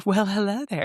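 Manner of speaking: late night radio voice. Retold or imitated. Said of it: "like the late night radio recording".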